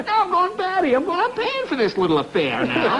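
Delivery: high-pitched, hammed-up exasperation; going batty. Husband paying for the call, comically exasperated. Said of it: "But I'm going like".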